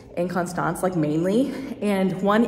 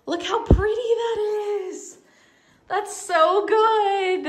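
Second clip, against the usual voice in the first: gushing; 12 semitones higher, much slower than usual. Real, not acted. Gushing over mom's outfit.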